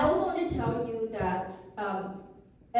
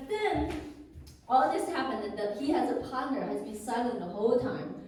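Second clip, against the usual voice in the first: ordinary talk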